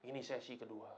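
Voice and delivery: understated, matter-of-fact mother eagle; unmoved mother's reply. Acted. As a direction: answer calmly and low, dropping away. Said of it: "Maknya bilang dari atas".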